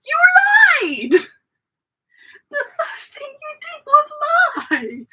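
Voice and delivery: high, slow indignant mock shout; mock outrage. Retelling the short date shouting at her, mock indignation. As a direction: wail it like an offended liar. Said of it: "This was just like".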